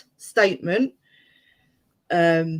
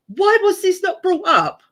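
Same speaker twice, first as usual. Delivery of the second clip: incredulous; 12 semitones higher, 5 dB louder than usual. Real, not acted. Indignant question at evidence.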